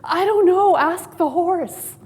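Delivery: high, loud shout, the last words slow; shouted reply. Acted. Rider on a galloping horse shouting back, high loud reply. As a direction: yell back over your shoulder at full gallop. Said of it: "the man turns to him and says".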